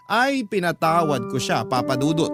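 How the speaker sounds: ordinary delivery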